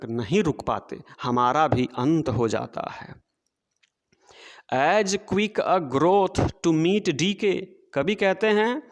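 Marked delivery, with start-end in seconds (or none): 4.7-7.6 very slow, measured verse reading; poem recitation. Shown as a demonstration. Teacher reading poem to children, slow recitation. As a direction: read the line out word by word for the class.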